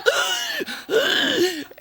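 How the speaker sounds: high crying gasp for air. Retold or imitated. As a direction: gasp hard like you're choking. Choking noise getting louder.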